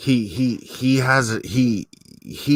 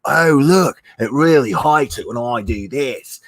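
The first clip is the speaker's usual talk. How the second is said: half-hearted showman delight; imitating Steve Irwin; 2 semitones higher, 4 dB louder than usual. Mocking Steve Irwin teasing a rattlesnake, lightly voiced cheer. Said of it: "tap it on its head and be like".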